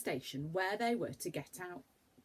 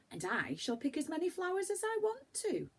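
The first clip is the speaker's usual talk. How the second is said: put-on high, bright little girl voice; a much higher girl's voice, delighted; eager girl; 11 semitones higher than usual. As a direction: sing it out like a happy young girl. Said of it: "said Beth".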